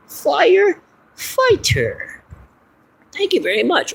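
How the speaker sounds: very high, very slow drawn-out puppet; proud puppet. Acted. Puppet proudly says firefighter.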